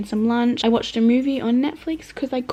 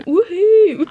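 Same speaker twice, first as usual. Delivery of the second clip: cheering whoop; 9 semitones higher, 5 dB louder than usual. Real, not acted. Home alone, chilling, cheering.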